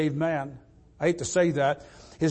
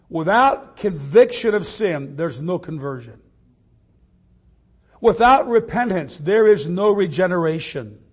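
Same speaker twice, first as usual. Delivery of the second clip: building preaching; 5 dB louder than usual. Acted. Preacher pressing the congregation, building to a loud climax. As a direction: drive the point home, rising.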